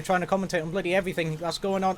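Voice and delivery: usual speaking voice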